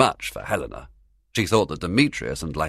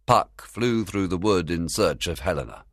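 ordinary delivery, nothing marked

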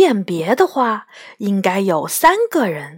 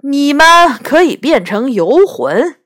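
loud, raised, insistent voice; acted quarrelsome comeback; argumentative retort; 4 semitones higher, 6 dB louder than usual. A narrator's reading. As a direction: push it at the others loudly. Second head argues back.

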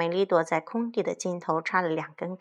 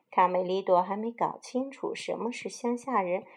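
plain reading voice

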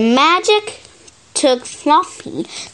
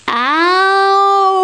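wolf howl; 9 semitones higher, 3 dB louder than usual. Acted. Wolves howling in the story.

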